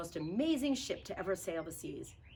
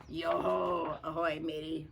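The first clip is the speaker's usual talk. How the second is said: usual speaking voice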